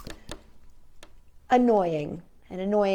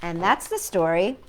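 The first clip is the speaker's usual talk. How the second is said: usual speaking voice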